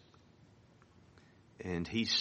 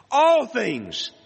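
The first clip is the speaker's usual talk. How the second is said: "All" punched much higher and louder, falling after; stress on ALL; 9 dB louder than usual. Shown as a demonstration. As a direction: hit "All" hard at the top of the voice. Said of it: "It's in an emphatic position".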